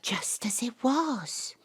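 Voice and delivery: soft hushed whisper; whispered memory. Acted. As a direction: breathe it in awe. Hushed in old hallway.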